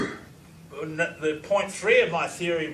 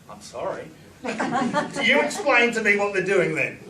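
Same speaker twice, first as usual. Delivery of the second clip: retold retort; 5 semitones higher, 5 dB louder than usual. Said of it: "And I say".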